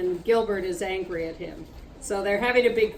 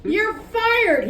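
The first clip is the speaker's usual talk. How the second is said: high scream; furious scream; 10 semitones higher than usual. Acted. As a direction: scream it as loud as you can. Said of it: "he screamed again as loud as he could".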